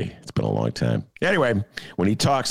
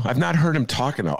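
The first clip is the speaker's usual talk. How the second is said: ordinary talk, nothing marked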